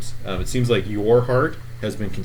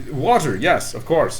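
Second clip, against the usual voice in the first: eager NPC; 6 semitones higher, slower than usual. Acted. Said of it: "he says".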